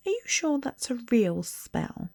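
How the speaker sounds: prim know-it-all, unimpressed; bossy girl. A narrator's reading. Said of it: "She had a bossy sort of voice".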